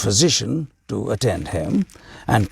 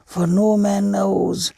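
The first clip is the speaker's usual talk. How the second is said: higher, gentle teaching hermit voice; patient sage explaining; wise old hermit; 8 semitones higher than usual. A narrator's reading. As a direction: hermit calmly teaching the king.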